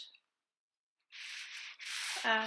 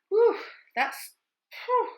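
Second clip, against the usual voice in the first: whew; 10 semitones higher, 7 dB louder, slower than usual. Real, not acted. Moved by the letter she read.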